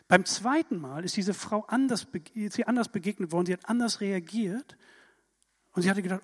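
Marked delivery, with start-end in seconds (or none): none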